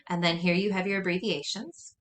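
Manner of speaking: ordinary delivery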